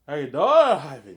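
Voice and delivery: loud, high, slow greeting; greeting a guy walking in, loud and drawn out; calling out greeting. Real, not acted. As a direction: call out to a visitor across the room. Greeting someone arriving.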